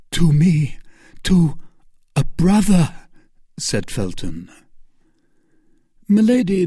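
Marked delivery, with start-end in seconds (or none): 0.1-2.9 put-on raised, earnest young man's voice; earnest young officer. A narrator's reading. Felton begging Milady to tell her story, earnest and raised. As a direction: plead with her to explain herself.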